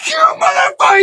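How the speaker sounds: high-pitched furious shout, imitating a friend; road-rage scream. Teller mimics friend cursing a cab driver, road-rage scream.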